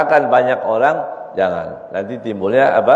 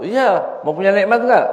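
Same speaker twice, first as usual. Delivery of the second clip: teasing Habib; 8 semitones higher than usual. Acted. Habib answers the worried father.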